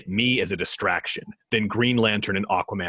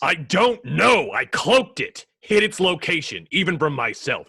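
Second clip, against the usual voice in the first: higher, louder cry of frustration; desperate yell; 10 semitones higher, 7 dB louder than usual. Acted. Batman yelling back at Superman under pressure, frustrated shout.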